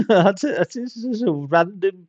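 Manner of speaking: tickled, giggly exclamation; amused laughing. Real, not acted. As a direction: talk through a burst of laughter. Laughs after reading developer's name.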